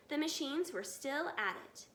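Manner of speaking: usual speaking voice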